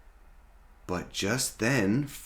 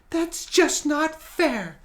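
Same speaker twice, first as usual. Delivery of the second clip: put-on much higher, louder girl's voice; young dinosaur Sally; 6 dB louder than usual. Sally protests to Rory in a high voice. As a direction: squeak it out, upset.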